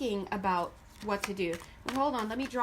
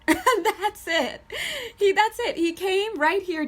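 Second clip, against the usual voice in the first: high, loud, laughing disbelief; 9 semitones higher, 7 dB louder, slower than usual. Real, not acted. A reader laughing at the shy spirit's brief answer, laughing and loud. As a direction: burst out laughing as you say it.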